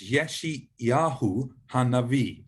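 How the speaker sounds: Yeshayahu Hanavi said slowly, syllable by syllable; slow for copying. Shown as a demonstration. Teacher says Yeshayahu Hanavi slowly so learners can copy. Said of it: "I'll do it slowly so you can have a go".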